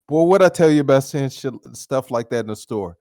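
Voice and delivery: put-on a mom reprimanding her boy; scolding mom. Mother scolding her son in the store, sharp.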